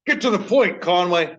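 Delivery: high, loud heckling shout; mock-impatient holler; impatient heckler. Mock-heckles himself as a listener.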